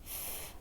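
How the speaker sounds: "f" as a soft voiceless hiss of breath; "f" alone, breath only; isolated F sound. Shown as a demonstration. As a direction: give just the sound of "f", not the letter's name. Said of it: "So we'll say".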